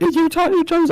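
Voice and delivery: high mock quote. Retelling dad's colleague.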